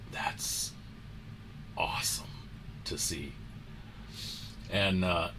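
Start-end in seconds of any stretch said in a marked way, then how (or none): 0.1-3.3 hushed, breathy whisper of awe; awed whisper. Retold or imitated. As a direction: whisper it in amazement. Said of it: "he's just like".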